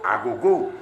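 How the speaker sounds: ordinary talk, nothing marked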